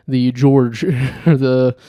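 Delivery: normal talking voice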